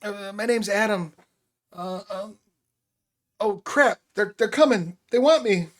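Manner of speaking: put-on scared kid seeing them come; panicked teenage boy. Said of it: "the teenage boy".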